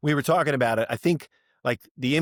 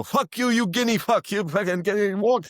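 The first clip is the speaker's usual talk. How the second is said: angry shouting; 8 semitones higher, 3 dB louder than usual. Retold or imitated. [name] shouting at [name] from the car, furious. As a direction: yell it out the car window. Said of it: "[name]'s still shouting out the window".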